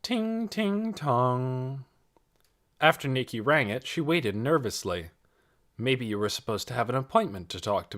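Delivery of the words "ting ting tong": doorbell chime. Retold or imitated. Narrator mimicking the doorbell, high sung chime. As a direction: chime it out like a three-note doorbell. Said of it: "three bell-like notes".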